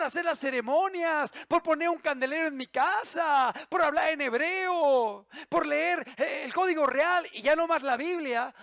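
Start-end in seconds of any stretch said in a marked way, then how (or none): none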